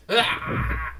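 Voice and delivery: short high shrieking cry; demonic pigeon screech. A narrator's reading. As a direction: let out a possessed bird's screech. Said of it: "it screeches out again".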